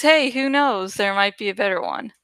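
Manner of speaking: ordinary talk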